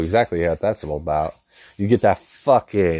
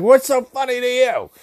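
very high, crackly grandma snap; cranky old lady; 4 dB louder than usual. Retold or imitated. Grandma confronting her laughing grandson, cranky old lady voice. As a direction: imitate an irritated old grandma, pitched way up. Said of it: "and she's like".

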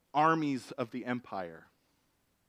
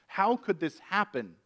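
louder, higher outraged king's cry; upset king; 5 semitones higher, 4 dB louder than usual. Acted. King outraged at the threat to his queen, crying out louder and higher. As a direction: demand who dared threaten her, indignant. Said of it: "the king is upset".

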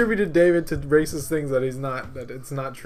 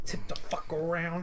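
unvoiced whisper; 7 dB quieter than usual. Real, not acted. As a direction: breathe the words without voice. Whispered aside.

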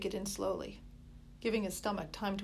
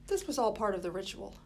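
ordinary delivery, nothing marked

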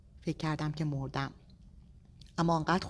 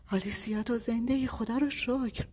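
relieved disbelief; 7 semitones higher than usual. A narrator's reading. Husband relieved she is alive.

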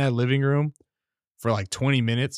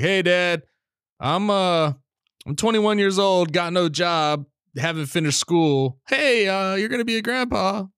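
higher-pitched voice for breaking news to dad; higher, put-on pitch, hesitant opener; acted announcement; 7 semitones higher than usual. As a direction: replay an awkward announcement, pitched up.

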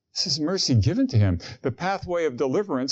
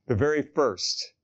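normal talking voice

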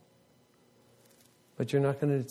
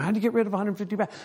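higher, fast panicked question; retold freakout; 7 semitones higher, much faster than usual. Preacher retells panicking on the rock, fast and high. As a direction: rush it out like he's losing it. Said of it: "full freakouts".

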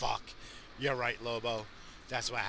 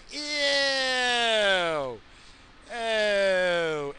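disgusted ew; 5 semitones higher, 4 dB louder than usual. Real, not acted. Disgust at the car.

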